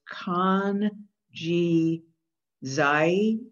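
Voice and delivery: "kan, ji, zai" slow, with "zai" as one smooth diphthong; syllable by syllable. Shown as a demonstration. Teacher sounding out the first word for students. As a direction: break the word into clear syllables. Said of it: "That I is a, what's called a diphthong".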